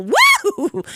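high whooping cheer. Real, not acted. DJ whooping with joy to listeners. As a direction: let out a joyful whoop.

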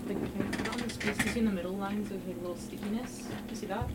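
quiet. Real, not acted. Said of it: "mic aside about the tape".

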